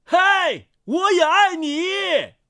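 high, drawn-out call held back in volume; roared reply. A narrator's reading. Mountain lifts its head to answer, calling out. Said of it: "吼道".

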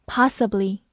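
"possibly" with an open ah vowel; American possibly. Shown as a demonstration. Teacher giving the American pronunciation for students, open ah. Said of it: "美式发音possibly".

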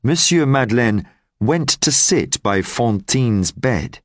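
plain reading voice

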